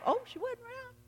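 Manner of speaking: high, soft tattletale voice; imitated tattling. Church member tells pastor the wife was absent, imitated tattling.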